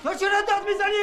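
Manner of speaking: angry shout. Acted. Customer to vendor in the quarrel, shouting. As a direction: yell it back at him. Bazaar quarrel over a coin.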